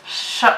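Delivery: sh said as a long hushed hiss; teaching the sh sound slowly. Shown as a demonstration. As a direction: blow out a steady sh. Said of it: "One more time".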